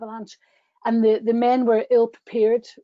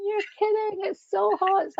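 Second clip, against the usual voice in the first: high, scoffing tourist voice; mock doubtful tourists; 10 semitones higher than usual. Tour guide mimicking the tourists who laughed at her warning, high and scoffing. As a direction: squeak it out in disbelief. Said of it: "They're going".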